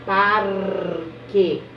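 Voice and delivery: teacher models Parque; syllable demo. Shown as a demonstration. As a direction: say Parque with ar pronounced together. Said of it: "Eu pronuncio junto".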